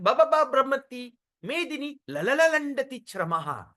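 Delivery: repeated "ba, ba, ba" before "brahmati"; stuttered "la, la, la" into "landati"; drunken stammer. Acted. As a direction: stumble on the first syllables of "brahmati" and "landati". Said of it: "Balaram became so intoxicated".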